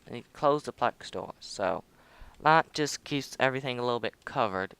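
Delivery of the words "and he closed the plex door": usual speaking voice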